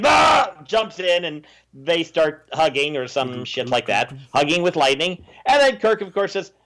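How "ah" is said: high loud hammed-up scream; a cartoon scream while leaping in; mock yell. Sybok leaping into the lightning, hammed-up high scream.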